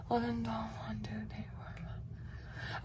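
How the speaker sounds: counting hours in a whisper. Real, not acted. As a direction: whisper the numbers to yourself. Counting hours under her breath.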